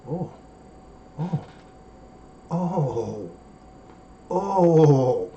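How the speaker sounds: drawn-out "Oh" of food bliss; savoring moans. Shown as a demonstration. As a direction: moan like tasting the best gumbo. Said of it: "I'm gonna show you".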